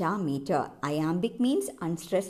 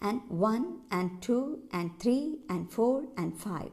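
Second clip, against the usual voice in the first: "and one, and two" counted in rhythm, light "and", stressed number; 3 semitones higher than usual. Shown as a demonstration. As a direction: count "and one" to "and five" as five even iambs. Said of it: "and, and എന്നുള്ളതൊക്കെ unstressed ആണ്". ("and one, and two" താളത്തിൽ, അക്കങ്ങൾക്ക് ഊന്നൽ നൽകി)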